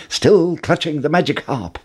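ordinary delivery, nothing marked

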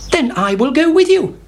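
high, eager hen voice; Henny Penny. A narrator's reading. Hen offers to join the chick, high and eager. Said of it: "said Henny Penny".

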